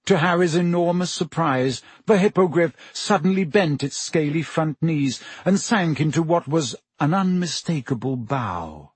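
ordinary delivery, nothing marked

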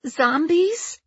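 very high, wailing over her gnomes; a heartbroken collector finding the wreckage; distraught Judy. Acted.

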